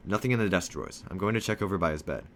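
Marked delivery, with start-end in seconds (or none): none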